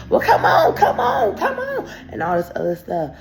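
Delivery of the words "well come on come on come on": high, loud come on come on; hammed-up dare to fight; mock taunting shout. Taunting the girl on the escalator, loud and high.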